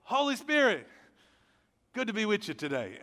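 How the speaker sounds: high, loud "Holy" said as "oly", lazy and sleepy; dropped H, tired. Retold or imitated. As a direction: drop the H in "Holy" like you're half awake. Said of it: "too tired to put the H in there".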